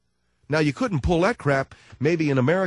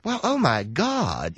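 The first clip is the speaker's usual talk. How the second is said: slow, gushing mock amazement; mock drooling awe; slower than usual. London host hearing of a senator, mock drooling awe. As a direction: gush like a starstruck restaurant host. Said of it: "they just start drooling over themselves".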